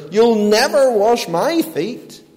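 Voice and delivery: high, loud, unapologetic blast; Peter. Acted. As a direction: blast out a flat refusal, hitting "never". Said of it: "another unapologetic blast from Peter".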